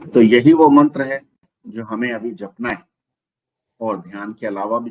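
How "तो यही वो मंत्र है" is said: loud announcement. Real, not acted. Host announcing mantra loudly.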